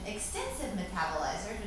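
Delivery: ordinary delivery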